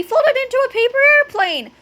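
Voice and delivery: high, loud frantic order; urgent cry. A narrator's reading. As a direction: cry out instructions in a hurry. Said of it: "cried Fluffy".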